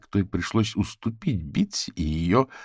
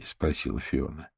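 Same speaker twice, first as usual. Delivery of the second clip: plain reading voice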